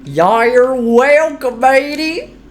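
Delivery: high, slow pirate-ish drawl. Acted. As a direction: play a cheery pirate. Voicing pirate-like character.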